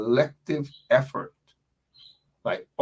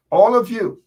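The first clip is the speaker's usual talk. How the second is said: "All of you" called out much higher and louder; emphatic call-out; 10 semitones higher, 7 dB louder than usual. Real, not acted. Rallying supporters, calling out "All of you" high and loud.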